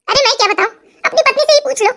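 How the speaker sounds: usual speaking voice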